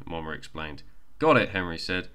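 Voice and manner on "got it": excited. Acted. Eager child agrees.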